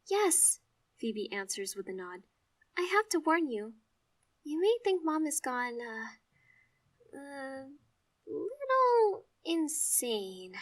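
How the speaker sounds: sister voice. Acted. Sister warns about Mom.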